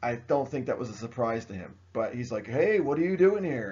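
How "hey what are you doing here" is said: higher-pitched, lightly hammed-up challenge; mock challenge. Washington finds the French out there, mock challenge. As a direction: call out a mock challenge to intruders.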